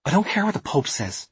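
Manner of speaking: high-pitched, irritated retort; snapping. A narrator's reading. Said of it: "snapped Alice".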